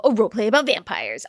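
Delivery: normal talking voice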